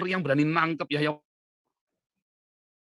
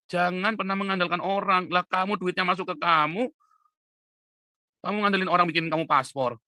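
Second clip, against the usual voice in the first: raised, higher-pitched admonishing voice; emphatic scolding; 6 semitones higher, 6 dB louder than usual. Real, not acted. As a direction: scold them loudly and insistently. Scolding people who rely on others. (menegur dengan suara keras)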